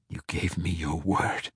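quiet, uneven gasp through pain. A narrator's reading. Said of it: "Drizzt gasped, and his words came out unevenly".

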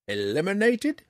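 high, rising echo of "Eliminated?"; shocked query. A narrator's reading. Old ranger questioning Drizzt's word "Eliminated?", taken aback. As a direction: say "Eliminated?" as a startled question, pitch climbing.

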